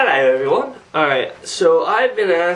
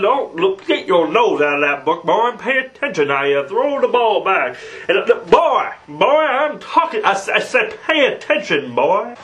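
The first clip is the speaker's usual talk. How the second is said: stammering, repetitive cartoon scolding; hammed-up, pushy cartoon know-it-all; blustering cartoon character. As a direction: lecture a boy with cartoon bluster.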